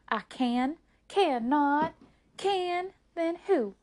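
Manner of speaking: put-on quick-fire childish contradiction, pitched high; volley it back and forth; bickering. Two sisters arguing over who can, bickering.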